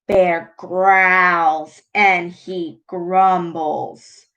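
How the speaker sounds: slow, rumbling read of the bear waking; dramatic read-aloud; storybook narration building menace. A narrator's reading. Reads picture book aloud.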